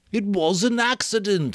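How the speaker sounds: insist, refusing to budge; stubborn. A narrator's reading. Piggy to Ralph, stubbornly insisting it was an accident. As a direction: dig in, flat and stubborn. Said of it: "said Piggy stubbornly".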